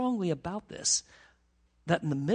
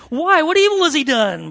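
much higher and louder plea; exasperated shout; 9 semitones higher, 12 dB louder than usual. Retold or imitated. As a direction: shout it at the crowd in disbelief. Pilate pleads with crowd.

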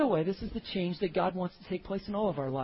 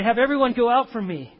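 loud cry; 5 semitones higher, 9 dB louder than usual. A narrator's reading. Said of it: "and he cried".

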